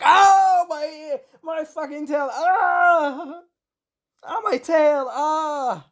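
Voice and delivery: high-pitched, hammed-up wail of pain; mock scream. Legoshi with his tail trapped in the door, mock screaming in pain. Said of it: "He screams".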